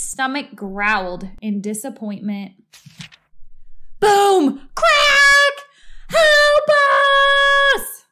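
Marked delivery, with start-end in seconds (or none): 4.0-5.7 high loud boom and shrieked crack; loud crash effects. A narrator's reading. Narrator voicing the collapsing floor, loud high crashes. Crash in a read-aloud story. 6.1-8.0 high, loud drawn-out cry; calling for help. A narrator's reading. Wife stuck in the hole calling out, high loud cry. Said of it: "Missus Watson called".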